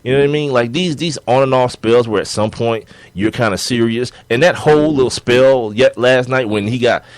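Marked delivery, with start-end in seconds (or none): none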